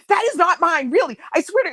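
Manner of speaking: high-pitched imitation of earnest denial; mock flustered insistence; embarrassed protesting. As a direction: plead innocence as a mortified sister. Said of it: "She was all embarrassed".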